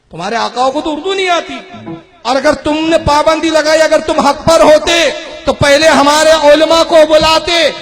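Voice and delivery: loud, high, driving accusation; full-force preaching. Real, not acted. Preacher accusing opponents of banning without debate, full-force preaching. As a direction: thunder the charge at absent opponents.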